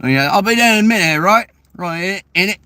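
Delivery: put-on fast, high British accent, T's dropped. Showing how the British drop T's, quick British accent. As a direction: swallow the T's like a Brit. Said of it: "the British got, like, something against T's".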